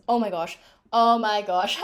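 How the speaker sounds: loud, exasperated groan of disbelief; exasperated oh my gosh. Real, not acted. Vlogger recalling French service, loud exasperation. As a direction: throw up your hands at the memory.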